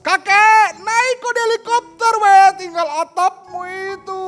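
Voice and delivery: high, loud shout from above; shouting from a helicopter. Acted. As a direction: yell down over the rotor noise. (teriakan keras dari helikopter)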